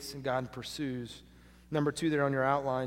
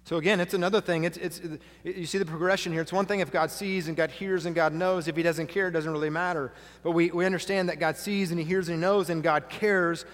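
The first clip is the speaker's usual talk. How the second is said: loud, driving pulpit delivery; full-force preaching; 4 semitones higher, 6 dB louder than usual. Acted. Pastor urging the congregation that God cares, loud preaching. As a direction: preach it out to the whole room.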